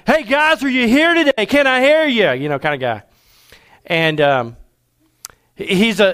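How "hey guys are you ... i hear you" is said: high, booming hype-man call to the crowd; rally the room like an excited MC; boisterous hype man. Retold or imitated. Imitating a boisterous pastor hyping up the crowd. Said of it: "he's real boisterous ... he's like".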